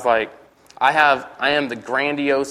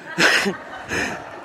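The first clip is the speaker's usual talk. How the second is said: airy burst of laughter; real breathless laugh; 5 semitones higher, 4 dB quieter than usual. Real, not acted. Telling the audience his story, breaks into a real breathy laugh.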